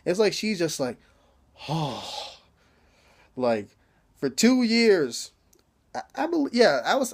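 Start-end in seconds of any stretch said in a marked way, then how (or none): 1.7-2.4 soft, breath-filled mock gasp; imitated gasp. Girl watching the beating, imitated soft gasp. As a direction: let out a soft sigh of awe.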